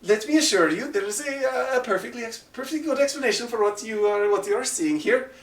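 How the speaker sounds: high, fast, over-polite excuse; a caught thug smoothing things over in a high voice; big man's excuse. Acted. The large man reassures the party, high and smarmy. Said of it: "And he goes".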